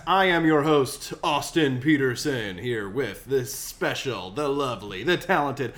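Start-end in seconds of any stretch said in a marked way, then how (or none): 0.1-2.6 big show-host intro; announcer voice. Acted.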